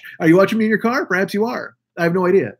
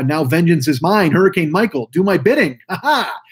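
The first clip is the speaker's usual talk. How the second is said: mock supervillain. Commanding his namesake hurricane, mock supervillain. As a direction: play the gloating villain, rising as you go.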